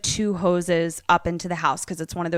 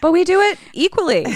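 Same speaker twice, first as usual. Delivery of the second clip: laughing; 11 semitones higher, 6 dB louder, faster than usual. Real, not acted. Laughing defence of the calls.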